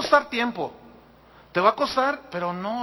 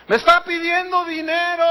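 loud, high-pitched public outcry; threatened shout; 10 semitones higher, 7 dB louder than usual. Acted. Pastor acting out his threat to expose a bribe-seeker, shouting. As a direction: shout it out as an accusation for all to hear. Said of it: "Voy a gritar".

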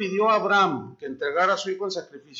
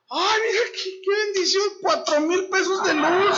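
much higher, gushing over the bill; sarcastic rapture at a high bill; mock delight; 10 semitones higher, 4 dB louder than usual. Pretend joy at a power bill, much higher.

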